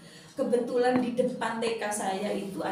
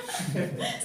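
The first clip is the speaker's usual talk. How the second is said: high-pitched amused laugh; giggle. Real, not acted. Teacher amused by her friend's envy, high giggle. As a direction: giggle at the retold squabble. Laughs after the mock argument.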